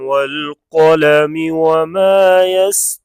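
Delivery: tajweed-style recitation; Quran recitation. Acted. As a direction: chant it with long held vowels. Recites verse again.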